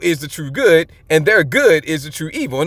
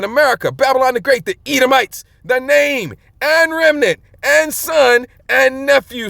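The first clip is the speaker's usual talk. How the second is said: fiery preaching; 4 semitones higher than usual. Acted. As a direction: proclaim it like a prophet to the congregation. Preaching scripture at full force.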